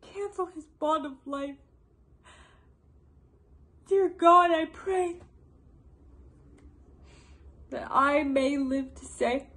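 fervent, halting prayer; Queen Margaret. Acted. Vengeful queen pleading with God, fervent and measured. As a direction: pray intensely, pausing between phrases. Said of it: "a portion of Queen Margaret's monologue".

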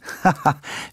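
short high nervous laugh; nervous burst of laughter. A narrator's reading. Charles scoffs at his mother's question, nervous laughter. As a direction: break into a brief, edgy laugh. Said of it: "en éclatant d'un rire nerveux".

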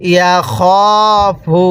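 "ইয়া খ ফু" with a long held খ; drawn-out vowel. Shown as a demonstration. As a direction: stretch the খ in "ইয়া খ ফু". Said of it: "এরকমভাবে শিখব".

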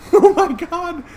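high, slow laugh through words; dissolving into laughter; laughing hard. Real, not acted. Laughing in disbelief.

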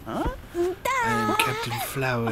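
squeaky high-pitched, cracking with laughter; high squeal of laughter on the title; laughing squeal. Real, not acted. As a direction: announce it through giggles, voice squeaking up. Proclaims title again, laughing.

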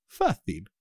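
ordinary talk

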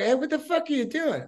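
sharp high yell of outrage; retold furious challenge; angry confrontation. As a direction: catch the thief in the act and call him out. Confronting a guy stealing his door.

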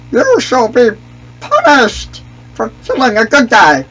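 cartoon creature praising, then threatening revenge; Misa voice. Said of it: "I could do anything with this voice".

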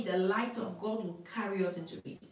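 usual speaking voice

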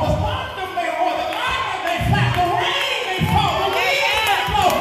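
rising, loud, high preacher's climb; preaching at full force. Acted. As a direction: build the congregation up to a roar.

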